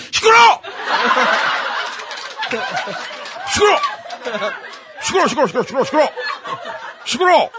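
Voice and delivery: high, sudden outbursts; hammed-up irritated yelling; irritated old man's shout. A narrator's reading. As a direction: cut everyone off like a cranky grandpa. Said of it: "할아버지는 짜증난 목소리로 소리쳤어요".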